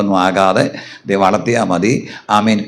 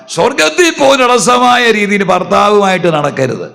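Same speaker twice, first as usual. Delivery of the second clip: raised preaching voice; 8 semitones higher than usual. Real, not acted. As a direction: press the warning on the listeners. Preacher exhorting the congregation.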